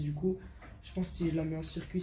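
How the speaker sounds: ordinary talk, nothing marked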